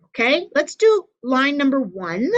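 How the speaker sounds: ordinary talk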